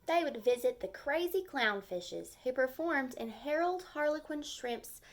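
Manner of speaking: gentle storybook read-aloud; lively story narration for young kids; reading to children. A narrator's reading. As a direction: read it like a picture book for the class. Teacher reads picture book aloud.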